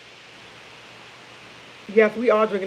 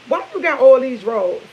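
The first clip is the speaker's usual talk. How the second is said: loud, higher-pitched mimic of son; imitating son; 4 semitones higher, 8 dB louder than usual. Son quizzing mom while folding laundry, imitated questioning. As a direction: quote the son's question, loud and raised.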